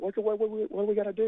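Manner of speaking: voicing scared gang members begging for help; mock frantic stammer; panicked plea. Gang members panicking, asking what to do.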